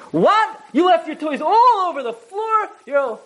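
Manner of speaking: shrill mock-tyrant outburst at toys on the floor; tyrant parent yelling. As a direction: bellow at the kid like a household tyrant. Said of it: "you're a tyrant".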